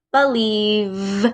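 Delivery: "Believe" with a lingering buzzed v at the end; careful demo of "Believe", ending vvv; final V held. Shown as a demonstration. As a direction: let the v of "Believe" ring on. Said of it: "right at the end".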